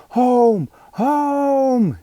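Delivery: high, loud call from the sidelines; shouting. Acted. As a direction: yell the throw home. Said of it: "shouted the boys from the sidelines".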